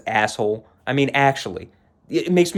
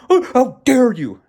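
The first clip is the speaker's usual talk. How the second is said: high, loud, hammed-up indignation; mock self-righteousness; 11 semitones higher, 7 dB louder than usual. Daughter imitating mom's show, self-righteous mock outrage. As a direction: throw a mocking show of outrage. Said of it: "she gives all these self-righteous kinda".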